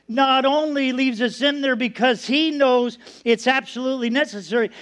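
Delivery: ordinary talk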